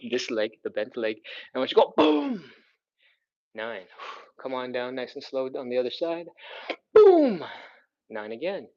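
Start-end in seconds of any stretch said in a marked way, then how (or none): 1.5-2.5 loud boom. Shown as a demonstration. Coach cueing an explosive push. 6.9-7.4 high, loud burst on boom; shouted boom. Shown as a demonstration. Coach cueing an explosive push.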